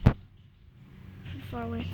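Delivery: slow, breathy whisper. Real, not acted. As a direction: hush it so the bird stays. Kid filming a bird outside.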